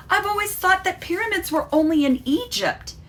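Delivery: high, bright amazed outburst; excited exclaim. Acted. Sophie telling Dad her surprise, high excited voice. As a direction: blurt it out in wonder. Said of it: "shouted Sophie".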